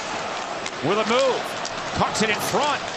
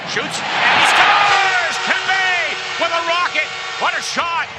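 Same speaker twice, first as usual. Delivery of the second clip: long goal yell; 4 semitones higher, 4 dB louder than usual. Real, not acted. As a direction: roar the goal, stretch scores. Goal call by play-by-play announcer.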